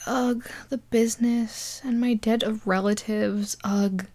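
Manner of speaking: hammed-up whispered lament; mock mopey sigh. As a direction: sigh it like a bored teen mourning life. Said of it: "she's like".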